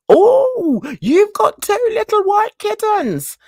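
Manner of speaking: high, hyper-excited squeal of the vet. Retold or imitated. Mimicking the overexcited vet greeting her and her kittens.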